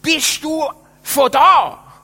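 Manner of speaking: high, loud, slow yell; shouting into an ear at a party; shouted over music. Retold or imitated. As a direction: yell it over a thumping club. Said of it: "einer hat mir so ins Ohr geschrauen".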